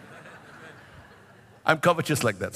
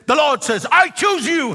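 high, loud proclamation building to the end; proclaimed prophecy; 8 semitones higher, 5 dB louder than usual. Retold or imitated. Evangelist declaring God's choice over a young pastor, loud high proclamation. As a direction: cry it out like a prophet, imitating the evangelist.

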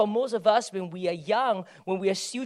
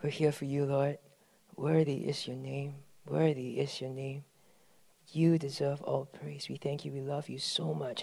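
soft, hushed prayer; closing prayer; 3 semitones lower, 5 dB quieter than usual. Acted. Pastor softly closes the prayer before the sermon. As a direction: pray it softly, winding down.

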